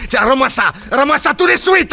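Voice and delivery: high, loud grabbing plea; snatch at the paper, shrill with panic; frantic demand. Acted. Paolo shouting at the man to hand the paper back.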